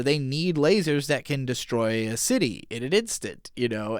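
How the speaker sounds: ordinary talk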